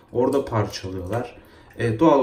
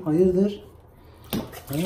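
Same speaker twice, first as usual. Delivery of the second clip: normal talking voice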